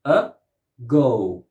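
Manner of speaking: "Ago" in two separate beats, uh, go; syllable by syllable. Shown as a demonstration. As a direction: break "Ago" apart with a pause. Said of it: "Two syllables".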